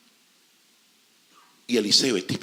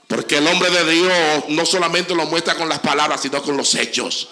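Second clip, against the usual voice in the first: much louder and higher, over music; full-force preaching; 9 semitones higher, 9 dB louder than usual. Acted. Pastor rouses the church to stand in loud preaching. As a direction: preach it out, bringing them to their feet. Closing call to congregation.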